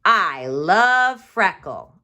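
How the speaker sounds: loud slow happy yell; slow joyful cry. Shown as a demonstration. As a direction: shout out your love for the dog. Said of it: "I yelled".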